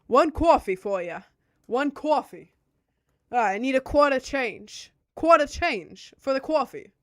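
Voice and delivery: loud, hammed-up New York barista; mock New York accent. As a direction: play a brash New York counter clerk for laughs. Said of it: "thought it was really funny to be like".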